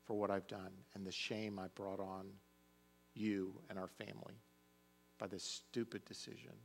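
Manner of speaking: softly, a hushed retold apology; a father softly voicing his son's remorse; mild quiet apology.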